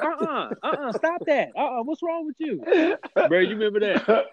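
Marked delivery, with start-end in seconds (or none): 0.0-2.6 high scolding mock voice; imitating the scolding person on the phone; disapproving caller. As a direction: squeak out a stern uh-uh. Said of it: "they was like".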